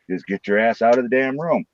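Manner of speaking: ordinary talk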